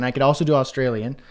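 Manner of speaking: ordinary talk, nothing marked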